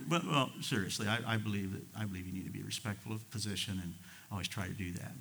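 usual speaking voice

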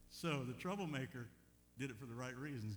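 normal talking voice

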